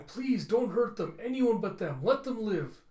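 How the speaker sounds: high, loud, slow pleading; robot boy begging the captors to spare his friends; desperate begging. Acted. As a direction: beg for their lives. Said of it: "you have [name] going".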